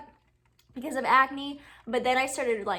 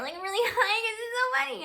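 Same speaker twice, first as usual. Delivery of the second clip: much higher, giggly talk; high laughing voice; 12 semitones higher, 4 dB louder than usual. Real, not acted. Showing viewers how she talks when something's funny. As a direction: let the laugh push the voice up high. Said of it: "I start talking like that".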